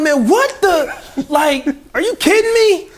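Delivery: slow, high, stretched outrage; outraged disbelief. Retold or imitated. Retelling grandma's refusal, high slow disbelief. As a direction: drag it out, shocked.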